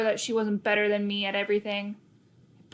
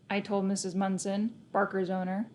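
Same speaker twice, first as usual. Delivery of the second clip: ordinary delivery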